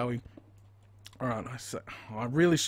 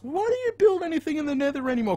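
mocking questioner; 13 semitones higher, 4 dB louder, faster than usual. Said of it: "people always ask".

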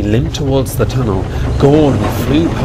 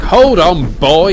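higher, louder cry to hold him back; urgent cry; 7 semitones higher, 5 dB louder, slower than usual. Acted. Driver holding back panicking James, crying out. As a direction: call out urgently. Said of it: "he cried".

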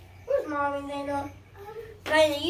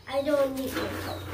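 usual speaking voice

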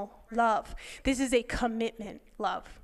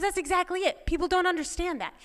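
higher-pitched, eager agreement; retold excited agreement; 6 semitones higher than usual. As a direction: quote a friend eagerly agreeing. Friend excitedly agrees in retold talk.

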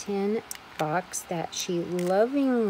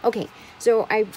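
ordinary delivery

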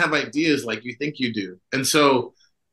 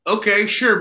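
usual speaking voice

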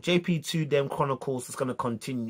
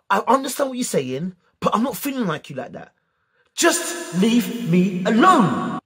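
high-pitched, building to a drawn-out yell; loud and passionate; 6 semitones higher, 3 dB louder, slower than usual. Retold or imitated. Telling the girl to leave him alone, loud and passionate. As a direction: start insistent, finish in a long loud outburst. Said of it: "I said it so loud and passionately".

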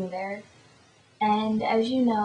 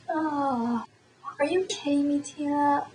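higher-pitched mock exasperation; imitated dismay; 5 semitones higher than usual. Friend learning it was a joke, higher dismayed voice. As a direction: act out a let-down friend, pitched up. Acted friend reacting to prank.

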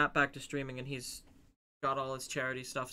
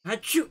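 higher, louder fake sneeze; mock sneeze; 7 semitones higher, 7 dB louder than usual. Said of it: "Matt just goes".

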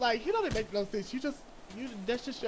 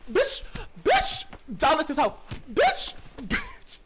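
shrill high shrieks, much higher and louder; excited screaming; 7 semitones higher, 7 dB louder, slower than usual. Real, not acted. Screaming at the game during a wild moment, very high and loud. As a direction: scream the word out in sheer excitement.

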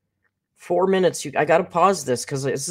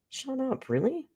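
1 shocked disbelief; 10 semitones higher, 8 dB quieter than usual. Real, not acted. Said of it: "reacting to fries price".

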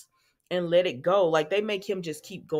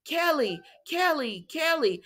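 retold yell; 8 semitones higher than usual. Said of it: "she's yelling".

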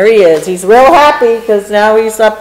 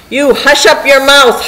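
higher-pitched kingly scolding; mild howl; 6 semitones higher than usual. A narrator's reading. Mighty king scolds complaining turtle, mild narrated howl. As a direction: shut the underling up, storybook style. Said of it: "howled the mighty King Yertle".

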